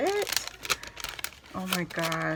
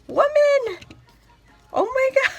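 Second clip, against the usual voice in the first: high, very loud squeal of delight; excited scream; 11 dB louder than usual. Real, not acted. Unboxing a friend's birthday mail, a thrilled shriek. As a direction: shriek with real surprise at the gift. Finds a gift card in mail.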